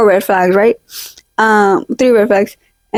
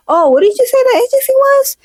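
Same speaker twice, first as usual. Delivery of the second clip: high sweet stalling voice; 11 semitones higher than usual. Retold or imitated. Stalling, pretending to know the agency.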